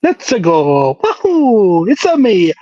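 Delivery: put-on high cheerful Mario with Italian accent. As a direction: bounce it out like Mario. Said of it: "that was a good Mario".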